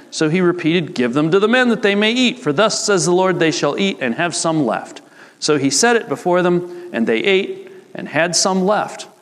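animated Bible story narration, clearly projected; scripture read aloud. A narrator's reading. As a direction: read the passage out to a congregation with lively rises.

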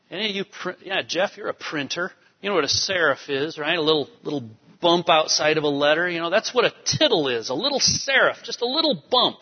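ordinary talk, nothing marked

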